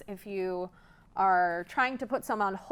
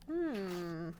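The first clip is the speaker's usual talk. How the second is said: plain reading voice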